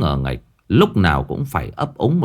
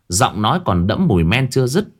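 usual speaking voice

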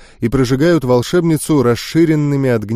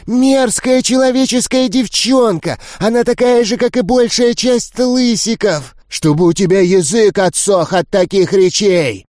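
furious ghouls; 6 semitones higher than usual. Acted. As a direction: spit the insult at the human girl. Ghouls insult the girl.

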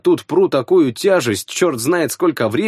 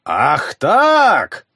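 eldritch horror; 4 semitones higher than usual. Acted. Monster answers the woman.

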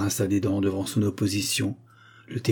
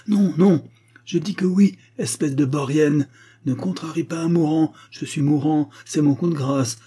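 high, loud agitated protest; worked-up dying man insisting; angry insistence; 6 semitones higher, 4 dB louder than usual. A narrator's reading. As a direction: overrule them vehemently. Dying man angrily insists on will.

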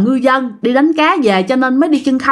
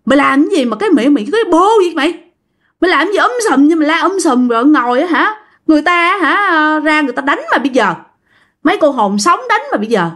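scolding yell; 4 semitones higher, faster than usual. Retold or imitated. Friends scolding the loudmouth on the bike, fast angry yell. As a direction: chew him out for the racket. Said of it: "mấy đứa bạn mới la".

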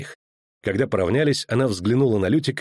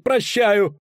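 high desperate cry; howled; 10 semitones higher than usual. Acted. Dandelion howling forgiveness at the block, high cry. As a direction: cry it out in panic. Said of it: "взвыл Лютик".

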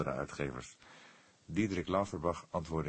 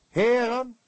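swollen, grand and much higher and louder; pompous; 10 dB louder than usual. A narrator's reading. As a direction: address them like a self-important gentleman. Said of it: "sprak hij gezwollen".

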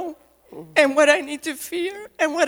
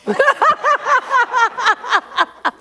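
high, loud self-conscious laugh; embarrassed laugh; 8 semitones higher, 3 dB louder than usual. Real, not acted. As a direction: laugh it off at yourself. Laughing at her own voice.

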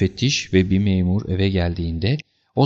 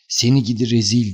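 mildly raised angry cry; understated furious shout; uncontrolled anger; 2 semitones higher than usual. A narrator's reading. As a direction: scold as if losing your temper. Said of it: "diye bağırdım öfkeme hakim olamayarak".

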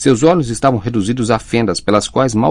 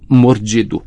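ordinary delivery, nothing marked

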